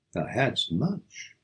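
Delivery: plain reading voice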